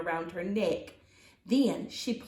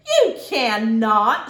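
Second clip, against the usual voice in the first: slow, loud and final; firm sister; 11 dB louder, slower than usual. Acted. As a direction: lay down the law. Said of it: "she said".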